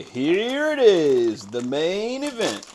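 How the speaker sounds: big slow, high showman build-up; announcer. Acted. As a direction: present it like a ring announcer. Announcing the favorite chip.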